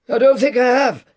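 put-on high, nervous old man voice; timid old Scrooge. A narrator's reading. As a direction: squeak it out nervously like an old miser. Scrooge answers.

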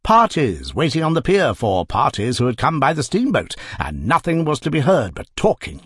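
ordinary delivery, nothing marked

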